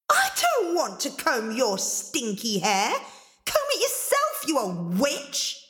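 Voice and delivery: high, rude whine; bratty refusal. Acted. Angelina insulting the ogress who demands combing, petulant. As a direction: shoot up in petulant protest.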